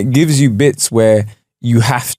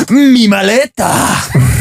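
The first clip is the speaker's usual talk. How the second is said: passionate app voice; 6 semitones higher, much slower than usual. Retold or imitated. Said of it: "I sound so passionate about saying these things".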